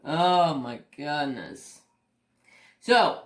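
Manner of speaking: usual speaking voice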